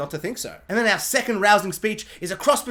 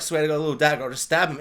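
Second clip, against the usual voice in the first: low elf; 6 semitones lower, faster than usual. Pretend. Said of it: "the elf isn't like".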